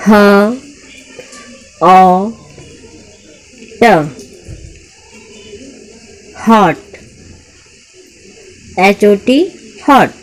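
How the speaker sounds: loud, slow blending of sounds, then the word; sounding out word. Shown as a demonstration. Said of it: "three letter word पढ़ेंगे". (ध्वनियाँ जोड़कर पढ़ना)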